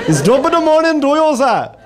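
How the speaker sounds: high-pitched American attempt at an Irish accent; mock Irish accent. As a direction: do a botched Irish accent that sounds Middle Eastern. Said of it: "try to do an Irish accent".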